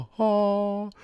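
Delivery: ordinary delivery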